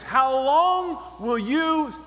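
impassioned challenge called from the city walls; crying out. Acted. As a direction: demand it of the whole crowd, leaning on key words. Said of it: "and she's saying this".

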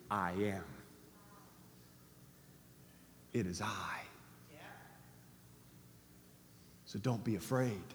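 gently voiced Jesus, soft and low; calm reassurance.

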